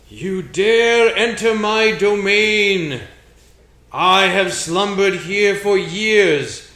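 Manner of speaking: slow, loud, booming threat; menacing ghost voice. Acted. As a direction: loom over them, drawing out each word. GM voices menacing elf statue.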